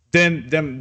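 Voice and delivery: normal talking voice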